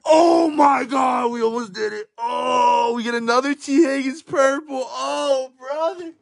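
high, stunned shouts; excited shouting. Real, not acted. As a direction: yell at the near miss. Near-miss pull, shouting.

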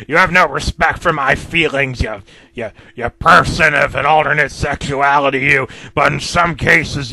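loud, bitter tirade in a put-on voice; villain hurling insults; angry rant. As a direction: tell him off, fuming. Mister Grumpy's letter, ranting at Tickle.

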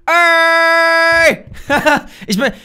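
usual speaking voice

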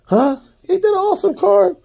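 very high, fast excited boast. Real, not acted. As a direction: squeal it out to the viewers. Showing off card to viewers.

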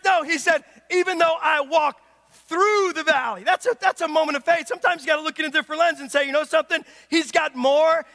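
emphatic preacher, voice up; full-force preaching. Acted. Preacher telling the congregation of unconditional love, full-force preaching. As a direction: drive it home to the congregation.

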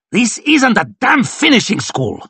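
high, forceful rebuke; angry roar. A narrator's reading. Lord marshal scolds noisy officers, angry roar. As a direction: keep roaring at the officers. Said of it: "roared Varus".

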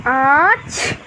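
a single sneeze; acted sneeze. Sick child at home, sneezing. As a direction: let out a sneeze.

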